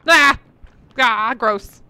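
loud startled yell; startled scream. Real, not acted. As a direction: shriek in disgust. Startled by gross noise.

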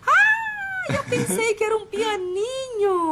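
high, slow whining sob; fake-cry in disappointment; mock crying. Comic disappointment at gift.

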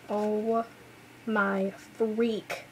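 ordinary talk

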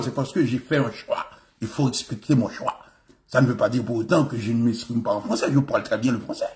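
formal French, pitched low and soft; français soutenu. Acted. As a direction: put on measured, proper French, low-voiced. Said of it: "c'est un français soutenu". (français soutenu, voix basse et posée)

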